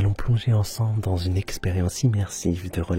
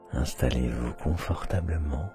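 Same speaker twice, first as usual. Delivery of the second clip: soft meditation voice; 5 semitones lower, 5 dB quieter than usual. Acted. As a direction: ease them in, barely above a whisper. Same words in meditation voice.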